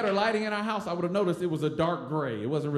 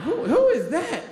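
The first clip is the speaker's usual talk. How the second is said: high, drawn-out, louder mock question; baffled onlooker; 11 semitones higher, 5 dB louder, slower than usual. Onlooker asks about the hooded stranger, high mock bafflement. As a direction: squeal it slowly in mock bafflement.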